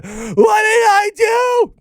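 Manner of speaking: very high, drawn-out mock cry; hammed-up wail. Christopher Robin pleading as his fiance is killed, hammed-up wail. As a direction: wail it slowly, sending up his anguish. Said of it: "all he keeps saying".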